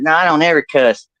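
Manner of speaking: lightly voiced grandma; denying grandma. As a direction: deny it as an indignant old lady. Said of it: "she goes".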